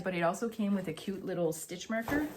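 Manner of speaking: usual speaking voice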